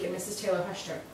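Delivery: ordinary delivery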